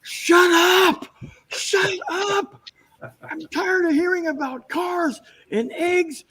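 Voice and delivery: very high, strained mock shout; fed-up director. Director turning on his chattering actors, high mock shout. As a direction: imitate an exasperated director, high and drawn out. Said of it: "Guillermo just turns around and goes".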